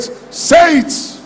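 high, forceful pulpit shout; shouted exhortation. Real, not acted. Preacher telling young people their future is great, shouted exhortation. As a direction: cry out the command to the crowd. Preacher demands the congregation repeat.

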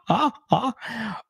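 ordinary talk

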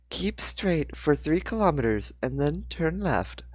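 a GPS giving directions; computer voice. A narrator's reading. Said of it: "the computer voice said loudly".

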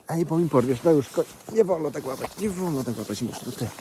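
sharp, high, loud telling-off; loud scolding. Real, not acted. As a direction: scold the donkey that grabs you, loud and high.

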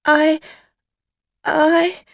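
high, loud frightened stammer; afraid. Acted. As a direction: stammer in sudden fear. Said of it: "Dorothy Burns was suddenly very afraid".

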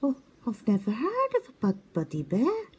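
high, puzzled and innocent; confused little owl voice. A narrator's reading. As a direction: stammer it in wonder. Twit amazed.

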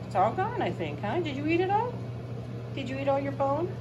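high sing-song talking to a pet; dog-talk voice. Real, not acted.

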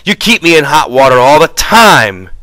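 exasperated near-yell; near shout. A narrator's reading. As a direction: let the anger rise almost to shouting. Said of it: "His voice rose nearly to a shout".

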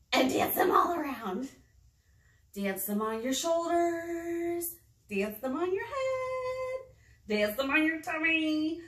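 high, slow, drawn-out singsong; playful finger-play cues. Pretend. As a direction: stretch the last word to match the movement. Leading children's finger play.

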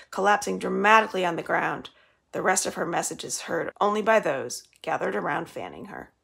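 ordinary delivery

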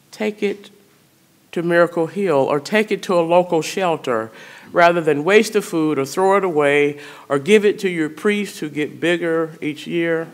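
normal talking voice

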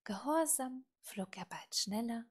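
ordinary delivery

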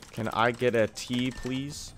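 slow, drawn-out order with a faint rising "please"; awkwardly over-polite tea order; odd polite ask. Real, not acted. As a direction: stretch the ask out, then let "please" float up softly. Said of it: "Why did I say it like that?".

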